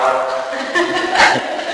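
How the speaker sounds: a quiet airy chuckle; laughing at his own wordless note; soft breathy laugh. Real, not acted. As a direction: let a soft laugh slip out. Laughs after reading own note.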